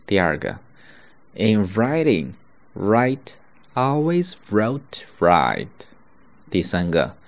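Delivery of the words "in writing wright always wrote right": always wrote right drawn out slowly; slow reading. Shown as a demonstration. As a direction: take it slowly. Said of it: "我再重新慢速的去读一下啊". (慢速朗读)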